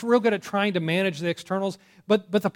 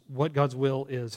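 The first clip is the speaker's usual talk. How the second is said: normal talking voice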